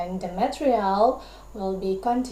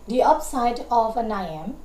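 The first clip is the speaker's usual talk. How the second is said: usual speaking voice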